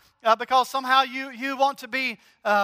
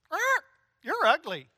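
high squawky parrot voice calling "Er, you're ugly"; squawking parrot; 8 semitones higher than usual. Acted. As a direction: squawk it like a rude bird. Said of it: "this parakeet goes".

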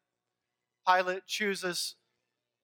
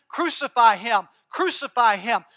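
loud, raised echo of the mob's chant; crowd's cry; 5 semitones higher, 10 dB louder than usual. Retold or imitated. Preacher voicing the mob's cry to the congregation, loud. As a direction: cry it out as the crowd once did.

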